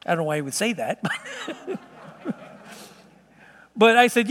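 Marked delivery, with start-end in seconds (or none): 1.1-1.8 short, soft, high-pitched laugh; a quick quiet chuckle; amused chuckle. Real, not acted. Pastor amused by the bacon remark, soft chuckle.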